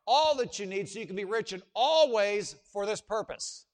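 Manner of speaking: loud, slow, stress every all; full-voiced pulpit emphasis; emphatic preaching. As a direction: drive home each all.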